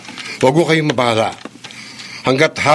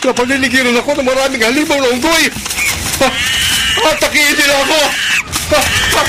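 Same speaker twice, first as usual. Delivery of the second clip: rising panic; 11 semitones higher than usual. Acted. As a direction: panic building as the monsters close in. Victor surrounded by monsters, panicking. (lumalakas na sigaw ng takot)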